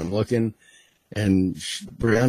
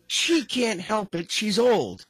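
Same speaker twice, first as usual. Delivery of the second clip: excusing daughter; 10 semitones higher, slower than usual. Retold or imitated. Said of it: "the daughter's like".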